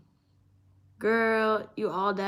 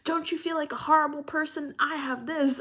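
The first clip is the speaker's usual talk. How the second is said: all caps indignation; 7 semitones higher than usual. Retold or imitated. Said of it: "type it out in all caps".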